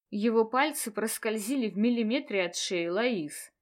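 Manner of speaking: usual speaking voice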